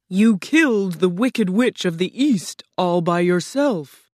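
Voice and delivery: loud, imposing statement; booming Oz. A narrator's reading. Oz credits Dorothy with the killing, booming. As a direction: boom it out with heavy stresses.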